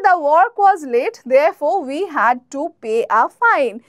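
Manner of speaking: very slow, clear classroom read; slow, careful teacher example; slow example sentence. Shown as a demonstration. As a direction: spell it out slowly for learners. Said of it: "আর কি আছে?".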